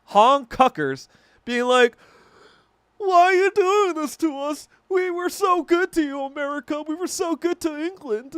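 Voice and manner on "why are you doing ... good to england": much higher, put-on whining plea; high mock-pleading voice, louder; mocking whiny voice. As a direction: whine it like a wounded ally begging. Mimicking a pleading, whiny group.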